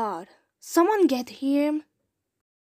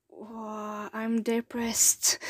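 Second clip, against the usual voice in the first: ordinary delivery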